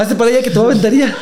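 high, fast words through real laughter; cracking up while shoving him off; giggly fast warning to a friend; laughing threat. Real, not acted.

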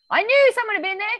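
high, loud suspicious declaration; mock suspicious. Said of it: "Claire's like".

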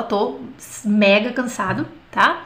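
usual speaking voice

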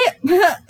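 brief nervous laugh; short laugh. Real, not acted. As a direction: laughs it off mid-sentence. Breaks off laughing mid-rant.

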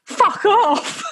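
high, laughing shout; mock shout. Boss telling the creepy old man, laughing shout. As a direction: bark it out while laughing. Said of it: "my boss came over and was like".

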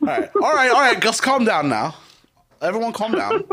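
high, loud mock British accent; hammed-up Brit calling for calm; bad English accent. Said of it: "it's so bad".

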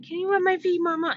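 put-on high little-girl voice asking sweetly. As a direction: pitch up to play a small child asking for a foot rub. Daughter voiced asking mother.

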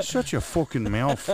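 playful put-on English accent; hammed-up English vowels; mock English accent. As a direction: tell them off as a joke Englishman. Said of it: "English accent".

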